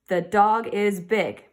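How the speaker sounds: hushed reading of a simple sentence; whispered statement. Shown as a demonstration. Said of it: "am I just telling someone something".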